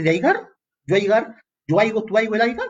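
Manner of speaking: high, incredulous mock conjugation; mock the wrong verb in disbelief. Shown as a demonstration. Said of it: "No existe aiga". (burla incrédula, aguda, de "aigar")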